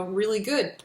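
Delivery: normal talking voice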